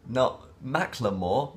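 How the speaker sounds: slow, drawn-out "Not Macklemore." with a rising end; mocking the pronunciation. Shown as a demonstration. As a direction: stretch "Macklemore" to mock how it is said. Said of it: "why are you saying it like that".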